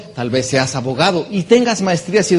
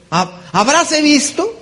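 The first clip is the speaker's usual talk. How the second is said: mock scandalized; 9 semitones higher, slower than usual. Mocking someone afraid of money.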